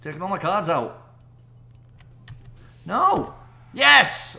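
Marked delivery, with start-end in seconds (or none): 2.9-4.4 loud high shout of triumph; excited cry. Real, not acted. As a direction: yell from dread to joy. Tense last card then win.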